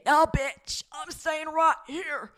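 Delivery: high, slow sassy friend who refuses to leave. Pretend. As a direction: dig your heels in with attitude.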